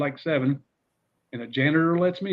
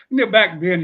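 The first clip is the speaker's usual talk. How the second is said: ordinary delivery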